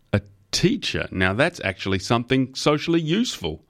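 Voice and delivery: high-pitched delighted exclamation; exclaiming. A narrator's reading. Company head approving of a former teacher, exclaiming. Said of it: "Malcolm Torrent exclaimed".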